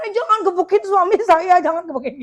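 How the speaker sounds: mock hysterical yell. Wife yelling at men beating her husband, mock hysterical shout. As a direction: play-act a panicking wife yelling, with a laugh. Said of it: "teriak-teriak, histeris gitu kan".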